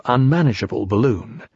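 usual speaking voice

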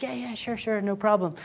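mock eager agreement. Designers agreeing to client.

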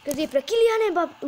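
high, loud goal call; excited commentary. Acted.

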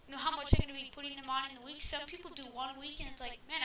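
normal talking voice

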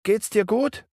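put-on high boyish worried question; a young boy checking on his sister; John's voice, high. A narrator's reading. John fragt Wendy besorgt, hohe Jungenstimme.

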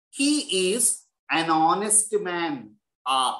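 He is an honest man said very slowly, then a; slow example. Shown as a demonstration. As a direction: read the example slowly and deliberately. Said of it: "see the other examples".

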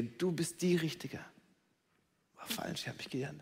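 flat whisper of disappointment. Acted. Disappointed person admits the mistake in a whisper. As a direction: drop to a bare whisper.

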